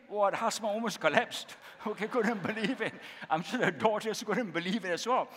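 laughing in disbelief. Real, not acted. Pastor recalling the stunned husband, laughing. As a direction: chuckle through the telling.

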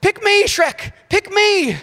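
high, loud, eager volunteer; Donkey. Acted. Donkey pleading with Shrek to pick him, excited high-pitched impression. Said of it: "And Donkey s-, goes".

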